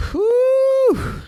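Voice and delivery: loud high exhale of relief; huge high whoosh of relief; relieved whew. Retold or imitated. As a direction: blow out the tension, big and high. Relief after waking up.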